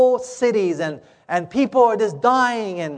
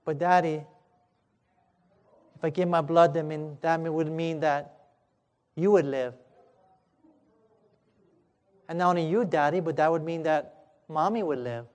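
put-on low, hushed, halting little-boy's reply; softly voiced child; 3 semitones lower, 8 dB quieter than usual. Little boy reasoning with Daddy about his sacrifice, soft and low. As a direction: work it out quietly, with long pauses.

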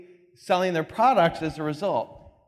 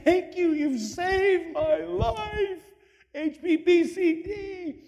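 much higher, slow and gushing; grateful girl; 12 semitones higher, much slower than usual. Retold or imitated. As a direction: gush your thanks, the girl saved at last. Grateful girl thanks the scientist.

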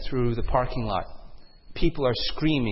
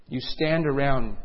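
ordinary delivery

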